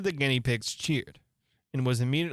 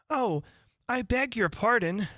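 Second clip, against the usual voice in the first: dismayed exclaim; 9 semitones higher than usual. Acted. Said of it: "exclaimed in a tone of great dismay".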